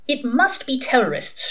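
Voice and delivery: high-pitched, irritated pronouncement; snapped. A narrator's reading. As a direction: snap it out briskly. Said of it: "she snapped".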